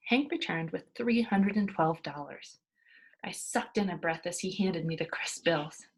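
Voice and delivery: usual speaking voice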